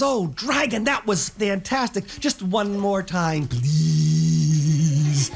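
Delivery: flattering princess. Acted. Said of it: "But Elizabeth says".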